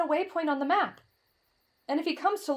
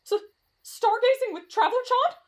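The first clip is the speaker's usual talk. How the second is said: smitten excitement; 7 semitones higher, 4 dB louder than usual. Acted. Smitten camper hears romantic offer.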